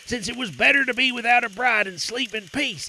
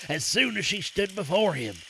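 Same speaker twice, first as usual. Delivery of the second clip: ordinary delivery, nothing marked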